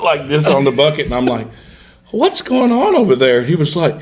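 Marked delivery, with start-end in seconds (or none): 2.1-3.4 high-pitched, baffled call across the boat; retold disbelief, pitched way up; incredulous question. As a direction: ask it in a high, comic voice. Acted question in funny boat story.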